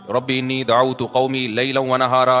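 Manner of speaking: slow, level measured chant; Quran recitation. Acted. Preacher quoting Noah's prayer to God, measured recitation. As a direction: recite steadily on an even pitch.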